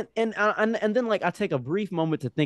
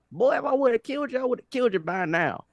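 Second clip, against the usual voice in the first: put-on Southern accent; 12 semitones higher than usual. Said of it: "Speaking a very Southern accent".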